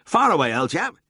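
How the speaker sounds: cheerful high-pitched prompt; eager cry. A narrator's reading. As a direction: pipe up in high good humour. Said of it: "he cried".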